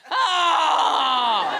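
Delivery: put-on loud childish cry; toddler son. Son calling from the back seat, loud childish cry. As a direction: wail out like a cranky toddler. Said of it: "he was going".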